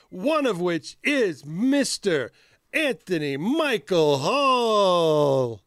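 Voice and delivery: slow, dramatic name announcement; drawn-out reveal. Acted. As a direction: stretch out each word.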